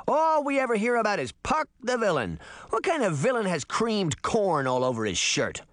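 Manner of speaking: mock him with some heat; scornful shout. A narrator's reading. Sabrina ridiculing Puck the villain, mildly shouting. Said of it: "Sabrina shouted".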